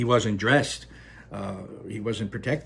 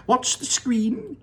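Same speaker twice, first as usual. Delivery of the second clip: Wonka crying out; 13 semitones higher, 4 dB louder than usual. Acted. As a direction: sing it out with delight. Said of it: "cried Mister Wonka".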